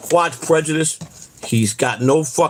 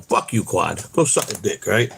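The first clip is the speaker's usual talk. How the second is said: ordinary talk, nothing marked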